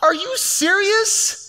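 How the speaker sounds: God aghast at settling for a bottle; climbing cry of what; God incredulous. Retold or imitated. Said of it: "I think God would say".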